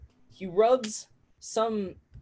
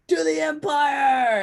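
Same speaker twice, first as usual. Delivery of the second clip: high, loud, drawn-out toast; rousing drunken toast; 9 semitones higher, 6 dB louder, slower than usual. Acted. Wobbly drinker toasting the empire from a bench, rousing shout. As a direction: shout a slow toast to the room.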